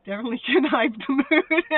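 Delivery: loud laugh breaking into the words; real laughter. Real, not acted. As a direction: crack up midway through reading the comment. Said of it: "My laugh is".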